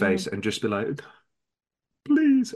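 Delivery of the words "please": high, begging fake cry; badly faked sobbing plea; mock tearful plea. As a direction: plead as if about to burst into fake tears. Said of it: "and start crying or something".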